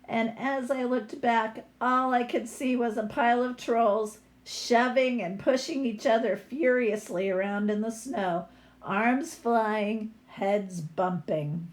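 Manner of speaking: lively picture-book read-aloud; story reading. A narrator's reading. Teacher reading a troll story to her class, lively read-aloud.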